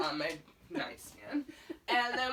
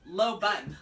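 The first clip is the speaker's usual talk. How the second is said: blunt firm low bun, punched out; deadpan order; 3 semitones lower, 4 dB louder than usual. Retold or imitated. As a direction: bark it like a stern stylist. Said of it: "So serious".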